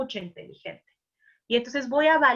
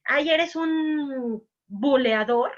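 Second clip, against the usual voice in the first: slow, higher mocking accusation; etiqueta burlona; 4 semitones higher, 4 dB louder, much slower than usual. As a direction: drag it out like a reproachful label. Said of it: "En vez de decirle". ("Ay, eres un buleador" lento, agudo y reprochador)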